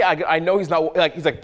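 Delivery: normal talking voice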